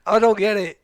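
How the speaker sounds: loud high burst of disbelief; exasperated outburst. Retold or imitated. Husband to wife, loud and exasperated. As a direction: throw up your hands in disbelief.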